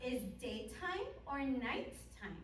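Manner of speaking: over-enunciate for beginner English learners; kids show host. Shown as a demonstration. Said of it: "But I want to do one with you".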